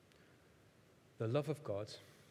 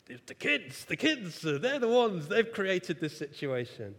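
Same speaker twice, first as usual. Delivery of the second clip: annoyed; 5 semitones higher, 6 dB louder than usual. Retold or imitated. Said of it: "I got really annoyed with her".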